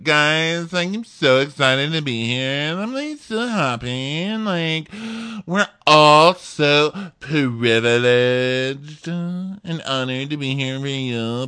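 fake-sweet contestant. Opera Vixen calling out the girls, fake-sweet and high. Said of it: "she's like".

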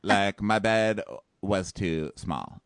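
slow, drawn-out complaint; slow whine. Retold or imitated. Host imitating Nick whining about his small bed, slow. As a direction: drag each word out, aggrieved.

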